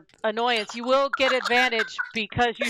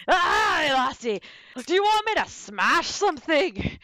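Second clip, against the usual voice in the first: Scottish brogue. Acted. GM voicing undead Scot.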